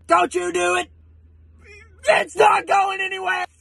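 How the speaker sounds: normal talking voice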